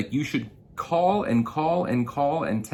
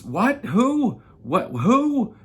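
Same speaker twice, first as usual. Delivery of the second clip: high, loud, baffled "What? Who?"; mock bewildered phone pickup; confused; 13 semitones higher, 7 dB louder, much slower than usual. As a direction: play the flustered guy caught off guard. Retold confused customer on phone.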